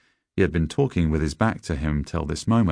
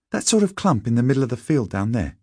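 higher, quick schoolboy voice; schoolboy character; 7 semitones higher, faster than usual. A narrator's reading. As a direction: pitch it up and hurry like a boy. Narrator voices a boy.

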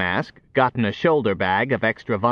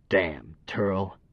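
softer, under-the-breath curse; muttered curse; 5 semitones lower, 8 dB quieter than usual. A narrator's reading. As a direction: grumble it low. Curse muttered while twisting.

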